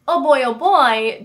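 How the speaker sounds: ordinary talk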